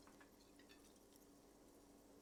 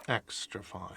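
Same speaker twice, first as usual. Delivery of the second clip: quiet murmur; 7 semitones lower, 8 dB quieter than usual. Acted. Quiet repeat of tag.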